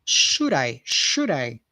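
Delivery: smooth linked "Should I" with a tapped d. Shown as a demonstration. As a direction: blend "Should I" into one flowing word, d tapped. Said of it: "So we say these two words together here".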